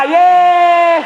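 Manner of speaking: a high, loud whoop; cheer. Real, not acted. Trainer whooping to get the audience clapping. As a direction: shout it out to fire up the room. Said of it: "Kasih tepuk tangan dulu yang meriah dong".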